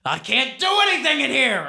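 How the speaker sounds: high, loud, fast outburst; mad at himself. Acted. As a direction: rant in frustration at yourself. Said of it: "really mad at himself".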